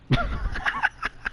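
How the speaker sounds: high, breathless laughing fit; helpless laughter. Real, not acted. Storyteller laughing at being thrown out, helpless giggles. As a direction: crack up in soft, squeaky bursts.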